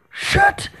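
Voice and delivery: very high startled curse; panicked swearing. Real, not acted. Player fleeing a fast enemy, panicked. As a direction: swear in a high squeal of fright.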